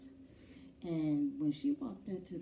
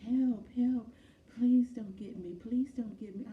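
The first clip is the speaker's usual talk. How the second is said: high, loud, panicked cries for help; mock hospital-bed panic, high and loud; retold terrified scream; 7 semitones higher, 8 dB louder than usual. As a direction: shriek it like a frightened patient. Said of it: "began to scream to the top of her lungs".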